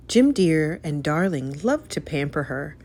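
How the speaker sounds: ordinary delivery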